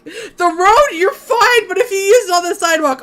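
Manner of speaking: ordinary delivery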